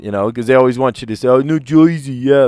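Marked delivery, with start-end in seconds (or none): 1.3-2.5 louder, higher mock New Jersey accent; exaggerated New Jersey accent. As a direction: caricature Joisey accent. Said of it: "nobody talks like that".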